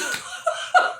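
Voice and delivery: high, wheezy laugh; soft, breath-heavy cackle at your own remark. Real, not acted. Viewer done with the show, wheezing laugh.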